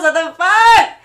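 loud, high excited shout; excited outburst. Real, not acted. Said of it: "I feel myself already getting excited".

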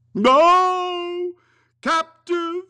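high, loud ghostly cry; crying phantom. Acted. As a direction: wail out in anguish. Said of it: "cried the phantom".

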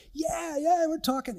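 high eager yeah yeah; excited. Retold or imitated. Grandfather on FaceTime with his son, eager. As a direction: mimics his own eager reply. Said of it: "And I'm like".

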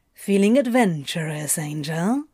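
low, teasing seductive question; seductive demon purr. A narrator's reading. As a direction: tempt him, low and sly. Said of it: "he asked".

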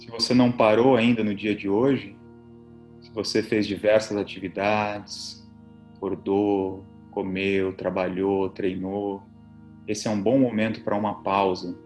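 soft, low relaxation voice; soothing spa whisper. Acted. Leads guided relaxation over music.

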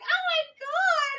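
put-on very high, drawn-out party-girl squeal; giddy exclamation. Missy being pulled up onto the boat, giddy high squeal. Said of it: "she's laughing as they're pulling her up".

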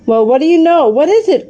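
high, lively exclamation and question; excited cry. A narrator's reading. Children crying out at a discovery on the climb, excited. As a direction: cry out, pitch jumping up. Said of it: "cried Jessie".

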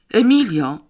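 loud, high call of her name; young man calling to Emilia, loud and high. A narrator's reading. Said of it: "zawołał młody człowiek".

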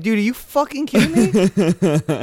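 loud, laughing disbelief, slowed down; half-laughing, stunned yell of disbelief; shocked disbelief. Retold or imitated. Retold shock at own accident.

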